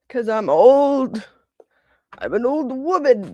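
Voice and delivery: mock old woman. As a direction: play up being old. Joking about age.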